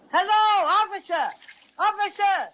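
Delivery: loud, high shout to the shore; big yell. Acted. Bear yells to the policeman, big voice. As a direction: bellow it across the water. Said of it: "let me do the yelling".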